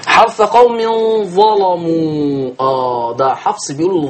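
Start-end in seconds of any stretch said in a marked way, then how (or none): 0.1-2.5 slow drawn-out recitation, heavy l in ظلموا; تغليظ اللام. Shown as a demonstration. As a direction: chant it long, thickening the lam of ظلموا. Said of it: "ورش بيغلظ". (تلاوة بلام مغلظة في ظلموا)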